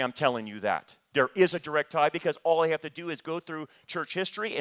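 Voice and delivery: normal talking voice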